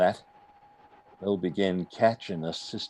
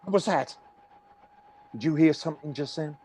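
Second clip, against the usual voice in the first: startled; 7 semitones higher, faster than usual. Acted. Stranger startled by a sound.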